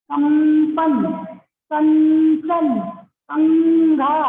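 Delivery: कंपन कंचन कंघा drawn out slowly, the nasal sounding after क; slow pronunciation demo. Shown as a demonstration. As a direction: stretch each word to show the nasal after क. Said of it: "क के बाद आ रहा है उच्चारण".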